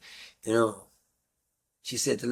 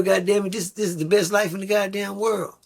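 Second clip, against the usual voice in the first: higher, showy man selling the high life; boastful pimp; 7 semitones higher than usual. Retold or imitated. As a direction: brag like a smooth talker. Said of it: "you can't tell a young girl".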